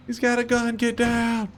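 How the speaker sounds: high, urgent yell to take cover; frantic high shout, get down; panicked shout. Real, not acted. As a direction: scream the warning in panic. Panicked callout in game.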